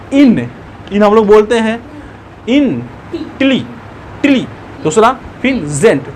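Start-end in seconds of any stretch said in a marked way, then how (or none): 2.5-6.1 इन, टिली, जेंट as isolated beats; teacher sounding out इन, टिली, जेंट; split in three parts. Shown as a demonstration. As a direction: pause between इन, टिली and जेंट. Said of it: "तीन बार बोलना पड़ रहा है".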